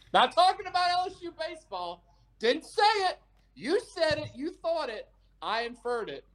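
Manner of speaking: high mocking recap of his excuses; teasing the co-host at the sign-off; mock denial. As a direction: rattle off his denials as a parody. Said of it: "mocking recap".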